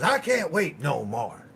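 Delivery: lightly voiced uncle bursting with news; mock excited uncle. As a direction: blurt it out like he can't hold the secret in. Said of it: "he goes".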